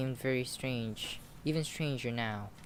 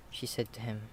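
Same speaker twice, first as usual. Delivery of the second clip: ordinary delivery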